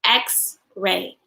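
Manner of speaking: "X-ray" in two separate beats; syllable demo of "X-ray"; broken into syllables. Shown as a demonstration. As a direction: pause between "X" and "ray". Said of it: "How many syllables".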